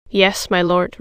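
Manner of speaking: plain reading voice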